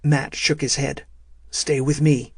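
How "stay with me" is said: plain reading voice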